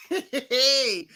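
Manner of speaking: a real laugh bursting through. Real, not acted. Vlogger cracking up at a viewer's comment, laughing. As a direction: break up laughing on the word.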